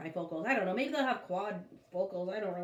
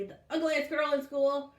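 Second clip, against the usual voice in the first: mocking taunt; 6 semitones higher, 4 dB louder than usual. A narrator's reading. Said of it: "snapped Bradley".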